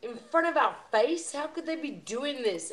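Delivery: high, loud imitation of an impassioned rant; passionate ranter. Imitating a passionate YouTuber to the group, urgent impassioned rant. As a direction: rail at the blind masses, pitched high. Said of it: "one of these passionate guys that just goes".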